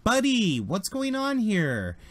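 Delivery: high, drawn-out call to a buddy; exuberant greeting. Acted. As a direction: hail a friend, stretching it out high. GM voices Kyle greeting his buddy.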